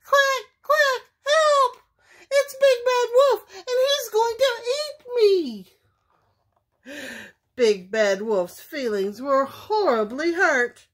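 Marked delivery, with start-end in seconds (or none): 0.0-5.3 very high, loud squeaky alarm; high quacking panic, speeding up; panicked duckling cry. A narrator's reading. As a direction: cry for help as a terrified duckling. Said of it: "cried number five".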